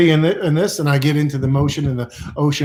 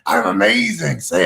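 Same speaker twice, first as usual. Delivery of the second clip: exultant affirmation; 4 semitones higher than usual. Shown as a demonstration. Leading the affirmation.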